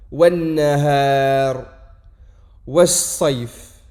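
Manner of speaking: Wan-nahar, was-sayf très lentement et plus fort, consonne doublée tenue; shadda appuyée. Shown as a demonstration. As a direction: tenir longtemps le n doublé. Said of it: "on ne prononce pas le lem". (insister sur la shadda)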